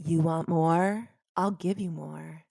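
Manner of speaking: low, slow, sultry catchphrase delivery; signature tagline voice. Shown as a demonstration. Host asks her to say her famous tagline, low and slow. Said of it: "can you say it for me? Your tagline?".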